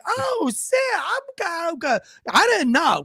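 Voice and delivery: high mock relief; lightly voiced neighbour letting out a sigh of relief; relieved neighbour. Neighbour answering the cop, high mock relief.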